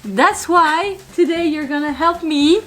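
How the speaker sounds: ordinary delivery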